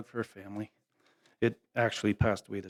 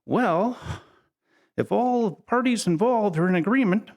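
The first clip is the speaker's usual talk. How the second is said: imitating the father-in-law, higher and measured; 11 semitones higher, 5 dB louder than usual. Father-in-law giving his blessing on the phone, imitated higher. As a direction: play the careful old rancher, pitched up. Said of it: "he came back and he goes".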